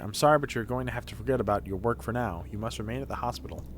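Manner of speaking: low, soft doctor voice; composed game doctor. Acted. Doctor telling a patient he must stay at the hospital, composed and low. Reads the game's doctor aloud.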